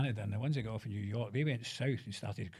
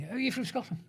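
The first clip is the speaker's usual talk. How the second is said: high-pitched impression of a curious woman; imitating London shop assistant; 12 semitones higher than usual. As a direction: ask it high, as the chemist's assistant. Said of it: "I went in a shop once in London".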